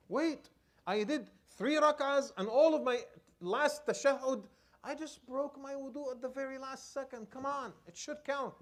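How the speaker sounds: high-pitched, insistent arguing; pleading protest. Acted. Worshipper arguing that the broken prayer counts, pleading protest.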